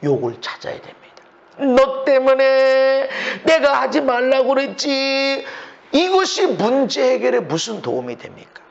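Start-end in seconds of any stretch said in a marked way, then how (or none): 1.6-5.4 drawn-out nagging reproach; I-told-you-so scolding; mock blaming. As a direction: stretch each phrase slowly into an accusation. Acting out blaming someone.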